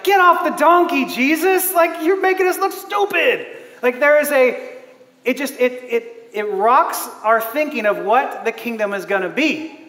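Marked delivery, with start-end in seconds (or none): 0.0-3.5 frustrated outburst. Retold or imitated. Peter to Jesus on the donkey, frustrated yell. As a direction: scold him for embarrassing you. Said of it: "a frustration".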